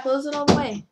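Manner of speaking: insistent call from across the room. Real, not acted. Calling to child about the door.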